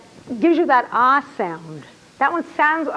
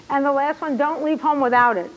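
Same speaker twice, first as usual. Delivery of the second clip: ordinary delivery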